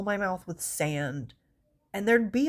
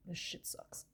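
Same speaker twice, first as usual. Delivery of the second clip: whispering it under breath; breathy whispered gripe; whispered aside; 15 dB quieter, slower than usual. Retold or imitated. Whispered complaint.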